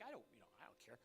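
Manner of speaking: low, soft, deadpan aside; inner indifference. Retold or imitated. His unspoken reaction to the bookkeeper's news, low and bored. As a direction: mumble it like he really doesn't care. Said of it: "I'm like".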